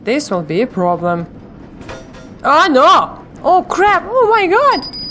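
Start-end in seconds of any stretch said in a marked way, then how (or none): 2.4-5.0 loud, high panicked exclamations; startled outcry. Real, not acted. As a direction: caught off guard, yell it out. Scary monster ambush in game.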